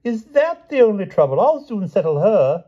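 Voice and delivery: high, loud, cocky exclaiming; reckless bravado. A narrator's reading. King promises to settle her, recklessly. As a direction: brush danger aside giddily. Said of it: "he cried recklessly".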